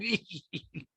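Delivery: high, soft put-on giggle; Rinna-esque giggle. Host mimics reality star's laugh, high soft giggle. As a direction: giggle in caricature of a reality star. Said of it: "the laugh is kinda Rinna-esque".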